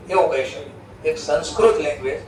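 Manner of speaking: usual speaking voice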